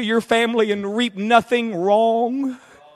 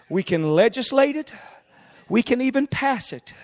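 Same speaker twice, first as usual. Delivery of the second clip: normal talking voice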